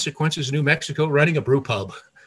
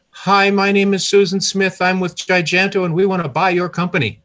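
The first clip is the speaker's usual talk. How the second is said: higher and louder, words tumbling out; in a rush; 6 semitones higher, 5 dB louder than usual. Retold or imitated. Caller pitching a buyout to Bud, rushed and louder. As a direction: blurt it like an eager caller who can't wait. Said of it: "Now he heard in a rush".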